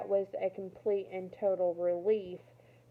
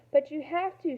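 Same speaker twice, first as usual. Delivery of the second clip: high, louder desperate plea; begging; 7 semitones higher, 5 dB louder than usual. Acted. Girl begging the resistance fighter to save her mother, loud pleading. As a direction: beg him as if it's the last chance. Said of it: "Samira begged".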